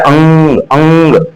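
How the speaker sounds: अंग with the ng ringing in the nose; nasal ringing. Shown as a demonstration. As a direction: hum through the nose on अंग. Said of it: "ये पर बज रहा है".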